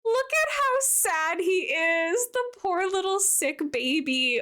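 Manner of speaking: high, slow, cooing pity for a little sick creature. Real, not acted. Cooing over a sick villager.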